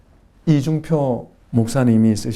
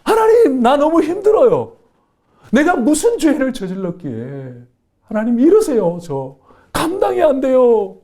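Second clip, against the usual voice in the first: pleading cry, pitched high, louder; crying out to God; 12 semitones higher, 5 dB louder than usual. Retold or imitated. Deaconess alone in the church crying out to God, pleading. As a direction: cry out to heaven in desperation. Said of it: "고래고래 소리를 지르는 거예요".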